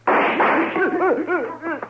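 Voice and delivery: put-on high rising drunken laugh; menacing laugh. Hank laughs at the marshal, menacing laugh. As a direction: laugh as he goes for the gun.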